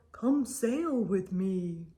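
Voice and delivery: a gentle giant inviting the small one; lightly voiced big kind whale; whale's invitation. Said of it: "and said to the snail".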